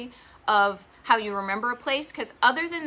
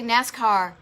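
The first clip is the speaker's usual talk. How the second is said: Boston NASCAR; 6 dB louder than usual. Shown as a demonstration. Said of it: "I would say".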